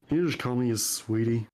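ordinary talk

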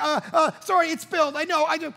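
high, flustered stammered apology; mock scared kid confessing a spill; imitated panicked child. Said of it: "you should see her face. It's like, terrified".

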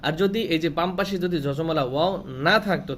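ordinary delivery

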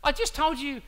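rising exasperation at the disciples who won't ask; frustrated Jesus. Retold or imitated. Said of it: "I can sense the frustration in him going".

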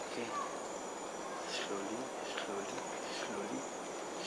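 very soft, careful undertone; hushed. Real, not acted. Creeping carefully past a tree.

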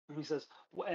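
usual speaking voice

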